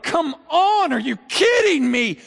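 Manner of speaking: high, loud angry come on; fed-up cry at the world; exasperated outburst. Pretend. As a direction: explode in frustration. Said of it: "you're like".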